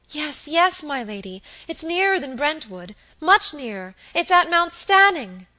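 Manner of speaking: insist, rising in panic; urgent insistence. Acted. Maid pressing Lady Audley about the fire, urgent and loud.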